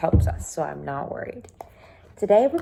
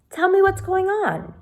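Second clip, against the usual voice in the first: much higher, leaning in curious; eager Sam; 13 semitones higher, 4 dB louder than usual. Acted. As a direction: press her gently to tell. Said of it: "He leaned across the table".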